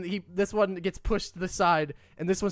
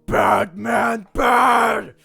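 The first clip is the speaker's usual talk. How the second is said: loud angry troll scolding; troll shout; 3 semitones higher, 10 dB louder, much slower than usual. Acted. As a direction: bark it like a dumb brute.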